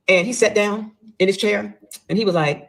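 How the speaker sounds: normal talking voice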